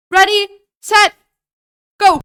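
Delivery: loud, high starting call; shouted countdown. Real, not acted. Streamer timing a run to the bedroom, shouted countdown. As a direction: call the start of a race at full volume.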